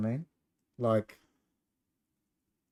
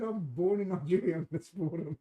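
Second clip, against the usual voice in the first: higher, teasing put-on voice quoting a friend; mocking imitation of friend; 5 semitones higher, 3 dB quieter than usual. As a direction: parrot his words back in a silly mock voice. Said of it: "He said".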